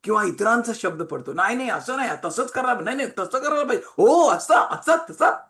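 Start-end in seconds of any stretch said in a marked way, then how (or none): 1.3-5.5 high, quick voices of busybodies; raised, excitable mock chatter; mimicked chatter. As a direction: imitate people butting in with advice. Mimics other people's distracting chatter.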